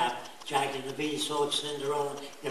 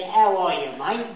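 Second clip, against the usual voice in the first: put-on Australian accent; 4 semitones higher, 8 dB louder than usual. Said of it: "If you're brought into Australia".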